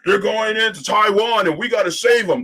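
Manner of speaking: hammed-up hawkish pundit, loud; mild mock war-hawk rant; mock warmonger. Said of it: "banging the war, the war drums".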